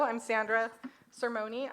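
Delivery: ordinary delivery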